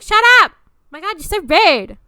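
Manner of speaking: high, loud mock outburst; exasperated yell. Woman fed up with a waffling guy, exasperated yell. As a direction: snap back at full volume, hammed-up.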